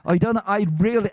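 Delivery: broad Bristol accent, imitated; Dave Prowse, broad Bristolian. Actor complaining his voice wasn't used, broad Bristolian accent. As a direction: put on a thick Bristolian burr, protesting. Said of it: "had a broad Bristolian accent".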